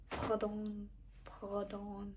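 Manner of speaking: "pardon" said the French way, pɑ-DON, lightly; retry "pardon" as a French speaker would, nasal final "don"; French accent retry. Shown as a demonstration. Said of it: "that was a terrible accent".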